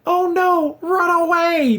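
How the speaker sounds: high, squeaky mock panic; panicky soldiers. Reviewer mocking fleeing soldiers, high squeaky panic. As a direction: ham up cowardly grunts fleeing in terror. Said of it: "the other soldiers are like".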